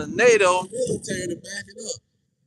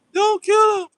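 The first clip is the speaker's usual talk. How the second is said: much higher and louder, a shouted cry; mock shrieking plea; 12 semitones higher, 6 dB louder than usual. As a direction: scream at the police to stop. Mocking a captive pleading for captor.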